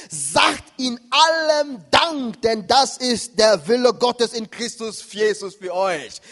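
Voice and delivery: loud, slow, emphatic scripture quotation; proclaimed Bible verse. Acted. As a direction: declaim the verse word by word.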